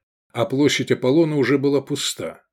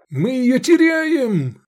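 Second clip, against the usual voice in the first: high, loud, stretched cry of alarm; panicked outcry; 14 semitones higher, 5 dB louder, much slower than usual. A narrator's reading. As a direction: shout it in desperation. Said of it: "выкрикнул Искандер".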